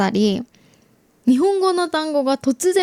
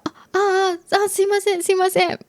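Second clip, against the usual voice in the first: flustered apology; 7 semitones higher, faster than usual. Retold or imitated. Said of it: "みたいになって".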